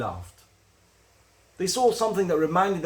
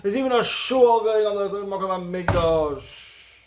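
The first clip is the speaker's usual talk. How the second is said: mock lament, pitched high and loud, drawn out; despairing wail; 6 semitones higher, 4 dB louder than usual. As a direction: wail it like someone imitating hopeless mourners. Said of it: "was one of despair".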